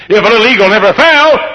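loud, high pulpit shout; impassioned preaching. Real, not acted. Preacher teaches about the eagle's fall, impassioned shout. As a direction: proclaim it at full force.

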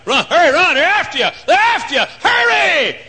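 acted high-pitched frantic yell; urgent shouting. Spectator urging fugitives to run for refuge, frantic high shout. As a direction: shout them on as if they're being chased.

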